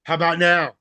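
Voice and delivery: mock yell from the other room; wife yelling back. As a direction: call it out like a punchline. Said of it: "she yelled back".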